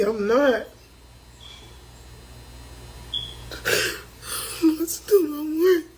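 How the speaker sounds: high, crying voice; overwhelmed and crying; real tearful breakdown. Real, not acted. Tearful breakdown over her troubles.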